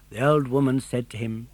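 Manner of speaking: plain reading voice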